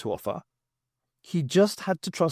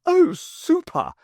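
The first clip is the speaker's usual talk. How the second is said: much higher, louder and slow; delighted Jeremy; 6 dB louder, slower than usual. Acted. Jeremy getting his shoe back, delighted. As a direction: gush with delight. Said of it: "said Jeremy".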